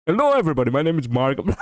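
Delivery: lightly voiced Markiplier intro; a hint of the YouTuber host; Markiplier impression. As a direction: loose Markiplier greeting. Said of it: "like he does on the".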